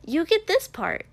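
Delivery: high, loud and thrilled; bubbling over with delight; excited. A narrator's reading. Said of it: "Mina said excitedly".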